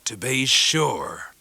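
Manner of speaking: slow rough fisherman voice; a homely old sailor, deeply moved; Mr Peggotty. A narrator's reading. Mr Peggotty welcoming guests by fire, rough sailor voice.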